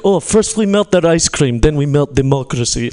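put-on comic Russian villain accent. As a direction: plot it in a mock Russian accent. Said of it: "I do love doing the Russian accent".

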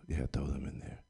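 hushed whisper. Retold or imitated. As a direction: whisper it conspiratorially. Whispered aside to the kids.

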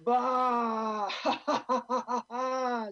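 loud, high bleat into mocking laughter; sheep laughing. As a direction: bleat then cackle at them. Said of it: "says the sheep".

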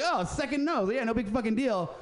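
mock casual. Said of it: "you can't just be like".

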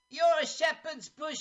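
a Cockney geezer sneering; Cockney accent. Retold or imitated. A movie fan mimicking a Cockney cabbie's insult, in Cockney. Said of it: "He sounds so Cockney".

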